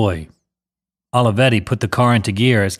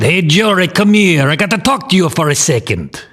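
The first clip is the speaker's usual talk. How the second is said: higher-pitched, raspy Italian mobster; raspy Italian accent; 7 semitones higher than usual. A narrator's reading. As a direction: call out in a rasping Italian accent. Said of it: "With a raspy Italian accent".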